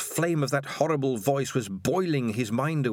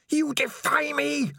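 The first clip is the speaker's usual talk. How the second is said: screamed. Acted. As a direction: shriek it in outrage. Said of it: "Spider screamed".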